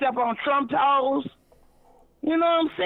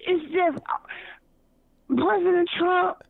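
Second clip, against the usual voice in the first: ordinary talk, nothing marked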